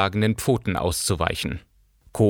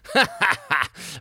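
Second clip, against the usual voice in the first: mocking laugh; 8 semitones higher than usual. A narrator's reading.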